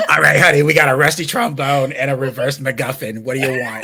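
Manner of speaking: put-on Boston smoker, loud at first; Boston accent. Selling to a customer, put-on Boston accent. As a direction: hawk it in a muddled Boston-British accent. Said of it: "That was my Boston accent".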